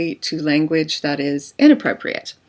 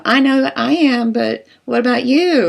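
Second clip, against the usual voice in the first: higher, bratty playground taunt; childish seventh grader; 6 semitones higher, 4 dB louder than usual. Acted. Defensive spouse answers like a kid, bratty higher taunt. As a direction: sound like a sulky seventh grader. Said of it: "that seventh grader quality".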